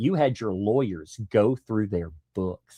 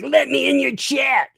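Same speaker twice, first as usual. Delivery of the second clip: high nasal-free mock plea; mocking whiny imitation; 13 semitones higher than usual. Streamer mimicking a critic begging to join, high mock whine. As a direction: whine it up high like a needy kid. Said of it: "He's like".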